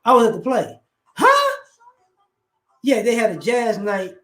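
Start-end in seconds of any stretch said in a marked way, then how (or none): 1.2-1.6 sharp high disbelieving huh; surprised huh. Acted. Friend reacting to news, high shocked huh.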